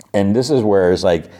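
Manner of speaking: ordinary delivery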